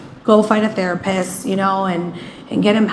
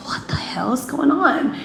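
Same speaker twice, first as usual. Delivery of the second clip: whispered, higher-pitched bafflement; hushed disbelief; 5 semitones higher, 4 dB quieter than usual. Retold or imitated. Wife hearing husband revving in the sand, whispered disbelief. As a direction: whisper it in retold alarm.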